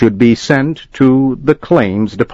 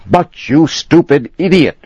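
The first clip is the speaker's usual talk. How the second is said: higher, raised insult shouted; furious shout; 8 semitones higher, 3 dB louder than usual. Acted. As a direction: hurl the insult at him. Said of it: "shouted Mister Morehouse".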